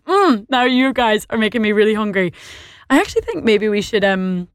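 ordinary talk

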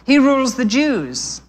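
pleased to know. A narrator's reading. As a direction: say it as if showing off what you know. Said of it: "answered Harrow, pleased that he knew so much".

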